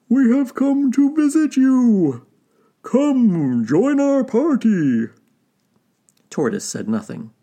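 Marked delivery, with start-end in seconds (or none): 0.1-5.1 high, loud, drawn-out invitation; calling out. A narrator's reading. Wolf invites Tortoise from the shore, calling out. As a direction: sing it out across the water as Wolf. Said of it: "called out Wolf from the shore".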